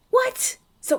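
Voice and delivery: sharp high startled what; a yelp of disbelief; high, loud, startled outburst; shocked. Acted. Shocked by the custom.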